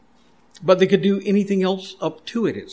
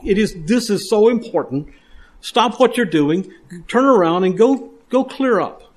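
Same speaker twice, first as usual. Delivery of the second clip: raised, urgent pulpit delivery, pressing each command; fervent preaching; 4 semitones higher than usual. Acted. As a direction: preach it like a call to act now. Preaching the altar passage urgently.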